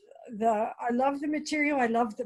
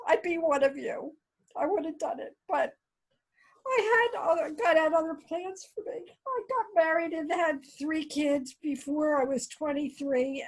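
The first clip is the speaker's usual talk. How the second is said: high, tearful, voice breaking; crying while still talking; tearing up; 7 semitones higher than usual. Real, not acted. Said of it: "I'm sorry, I'm so emotional".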